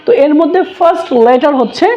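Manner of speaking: ordinary talk, nothing marked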